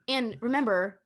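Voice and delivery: usual speaking voice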